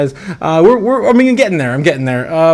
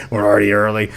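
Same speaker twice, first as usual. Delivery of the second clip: a lower, settled second take; lower echo; 7 semitones lower, faster than usual. Shown as a demonstration. Streamer marvels at the Bitcoin chart, then echoes lower. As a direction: repeat it lower, like an aside. Streamer repeats line to chat.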